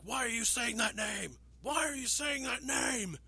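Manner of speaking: loud, high, drawn-out scream; screaming Batman. Retold or imitated. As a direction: demand it in an anguished mock scream. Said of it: "He keeps screaming out".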